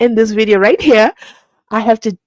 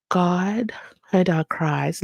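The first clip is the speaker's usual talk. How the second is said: hushed unvoiced prayer, slow; whisper; 2 semitones lower, 8 dB quieter, much slower than usual. Real, not acted. Vlogger to viewers, a slow hushed whisper. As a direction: breathe it like a secret prayer.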